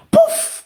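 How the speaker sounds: sudden high-pitched "pouf"; explosive pouf. Shown as a demonstration. Showing how anger erupts over nothing, explosive pouf.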